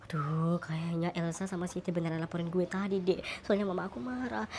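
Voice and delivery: hushed dread under his breath; fearing that mum knows; worried aside. Acted. Worried aside after being caught.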